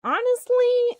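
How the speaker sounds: high emphatic honestly; high exclaim. Real, not acted. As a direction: burst out with it. Exclaimed to chat.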